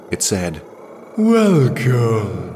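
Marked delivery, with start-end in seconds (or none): 1.2-2.5 loud, high, ringing welcome; mocking alien voice. A narrator's reading. The light figure greets Jirel at the door, mocking. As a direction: let the greeting resound like an alien call. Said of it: "It mocked her".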